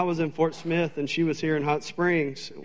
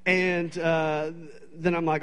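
normal talking voice

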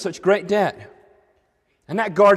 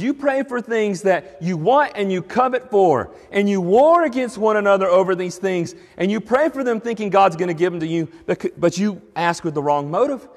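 scripture reading; 4 semitones higher than usual. Acted. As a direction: read the verse out with weight. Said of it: "he says".

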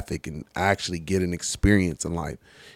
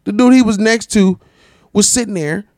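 excited disbelief; 11 semitones higher, 7 dB louder than usual. Real, not acted. Stunned at cops beside smoker.

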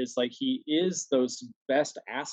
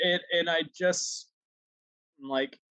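ordinary talk, nothing marked

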